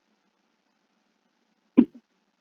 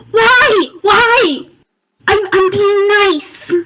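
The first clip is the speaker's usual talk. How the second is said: high, wailing mock complaint; wounded protest; 6 semitones higher, much slower than usual. Character scratched by her raccoon, wailing in protest. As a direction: wail it, then drag out the protest.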